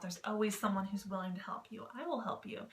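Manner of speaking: usual speaking voice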